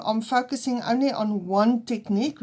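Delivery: ordinary delivery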